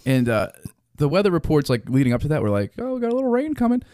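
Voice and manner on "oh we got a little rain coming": much higher, quick breezy forecaster voice; mocking weatherman. Friend mimicking weather reports that played down the storm, high and breezy.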